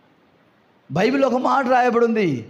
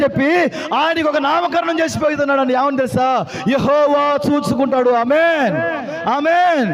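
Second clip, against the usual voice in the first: full-voiced proclamation with ringing amens; fervent preaching; 6 semitones higher than usual. Real, not acted. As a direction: rouse the congregation with each phrase. Preacher proclaims God provides. (ఉత్సాహంగా, గట్టిగా ప్రకటిస్తూ)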